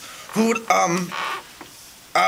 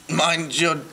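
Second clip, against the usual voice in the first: Australian shark voice; 4 semitones lower than usual. Pretend. Retelling the movie, shark voice in an Australian accent. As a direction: play the shark, Australian mate.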